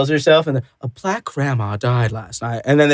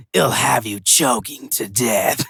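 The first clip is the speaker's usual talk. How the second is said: low, slow, softer trailer announcer; ominous movie trailer tagline; hammed-up trailer voice; mock trailer voice; 5 semitones lower, 4 dB quieter, slower than usual. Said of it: "They're like".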